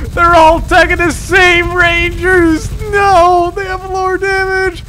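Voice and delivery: high, loud alarmed yelling; alarmed shouting. Real, not acted. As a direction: shout in dismay. Towers targeting same Rangers.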